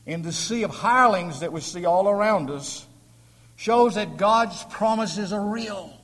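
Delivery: booming pulpit declaration; preaching at full force. Acted. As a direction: proclaim God's promises to the hall.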